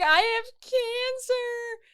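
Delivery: high, slow bawling wail; wailing cancer. Retold or imitated. Drugged daughter telling her parents, a slow high wail. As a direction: wail it out through tears. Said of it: "And I'm like".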